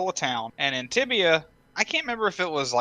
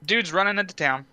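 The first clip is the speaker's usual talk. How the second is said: normal talking voice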